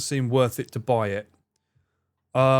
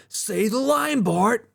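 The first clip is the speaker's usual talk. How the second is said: much higher cartoon kid voice; cartoon kid voice from The Simpsons; Simpsons kid impression; 9 semitones higher, much slower than usual. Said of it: "that kid from The Simpsons".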